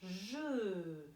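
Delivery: "je" held long by itself; isolated first syllable. Shown as a demonstration. Teacher isolates the first syllable, "je" drawn out long. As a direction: stretch "je" out as a syllable alone. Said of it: "En premier, j'entends je".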